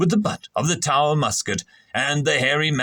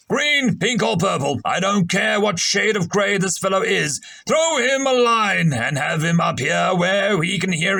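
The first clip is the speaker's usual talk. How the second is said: gruff commanding captain; 6 semitones higher than usual. Acted. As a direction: bellow orders across the deck. Captain gives orders on deck.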